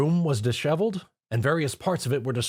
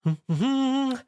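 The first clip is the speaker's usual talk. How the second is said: high giggle; 14 semitones higher than usual. Acted. Scene break laugh.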